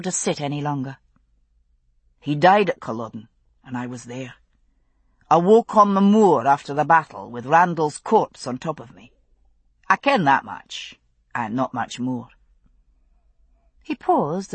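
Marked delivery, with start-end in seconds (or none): 2.2-12.3 lower, steady Highland father; Scottish father voice. Acted. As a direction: speak as a grave Scottish father.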